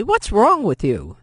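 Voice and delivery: mother scolding her sister for slapping Sophie; harsh. A narrator's reading. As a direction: snap the name to stop her. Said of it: "Vianne said harshly".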